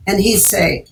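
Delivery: ordinary delivery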